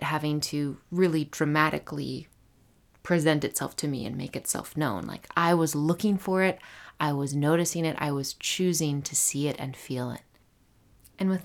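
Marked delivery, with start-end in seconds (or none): none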